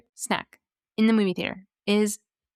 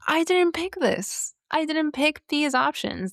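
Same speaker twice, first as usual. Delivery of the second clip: exasperated protest; 7 semitones higher than usual. Real, not acted. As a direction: protest in a raised pitch, stretching the first line. Protesting the given dialogue options.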